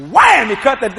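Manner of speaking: usual speaking voice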